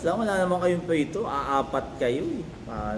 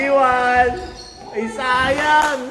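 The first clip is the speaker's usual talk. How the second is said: loud, high disappointed cry; shouting; 7 semitones higher, 5 dB louder, much slower than usual. Real, not acted. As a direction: yell it out at the miss. Missed shot during game. (sigaw sa sayang na tira)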